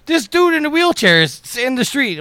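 very high, loud imitated alarm; incredulous exclamation. Imitating the friend who spotted the wheelchair, incredulous. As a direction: mimic a friend's high-pitched disbelief.

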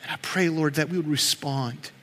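prayer. Acted. Pastor praying over the hurting congregation, soft and earnest. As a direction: pray it quietly over the church.

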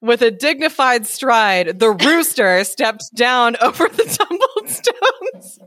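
slow storyteller starting to crack up; story narration. A narrator's reading. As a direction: read aloud with a giggle creeping in. Reads story, breaking into laughter.

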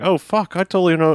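high, excited retold realization; sudden recognition. Co-host retelling the moment he knew the actor, sudden recognition. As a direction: replay the moment it clicks.

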